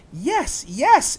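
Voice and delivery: put-on high excited girl's call. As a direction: answer eagerly from ahead. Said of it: "Annie called".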